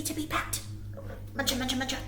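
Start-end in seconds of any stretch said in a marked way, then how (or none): none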